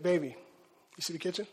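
hinting to wife. Retold or imitated. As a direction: drop it low and hint at the dishes. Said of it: "I'm like".

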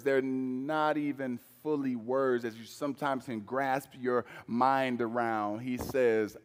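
normal talking voice

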